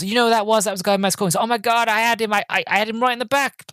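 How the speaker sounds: high-pitched, mock-astonished taxi driver; astonished retelling. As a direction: act out a driver's amazed outburst. Retells the other driver's astonished outburst.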